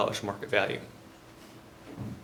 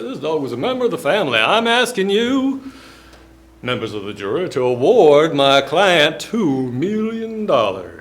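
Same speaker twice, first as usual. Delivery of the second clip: put-on Mississippi lawyer accent; 3 semitones higher, 7 dB louder than usual. Lawyer asks the jury for two million in a Southern accent. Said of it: "this is what people sound like in Mississippi".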